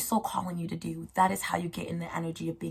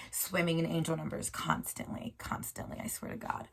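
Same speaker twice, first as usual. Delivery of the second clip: ordinary talk, nothing marked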